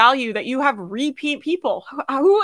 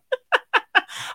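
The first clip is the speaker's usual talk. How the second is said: high laugh; 13 semitones higher, 3 dB quieter than usual. Real, not acted. Laughter trailing off.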